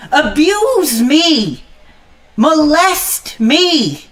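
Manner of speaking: high, very drawn-out dare; defiant challenge. Acted. Lone fan challenging the haters, high drawn-out defiance. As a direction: stretch each word out, daring them.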